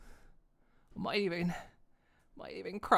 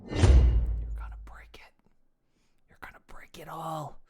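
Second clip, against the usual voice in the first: whisper; 2 semitones lower, 6 dB louder than usual. Pretend. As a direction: breathe the warning out. Whispered warning, mock dread.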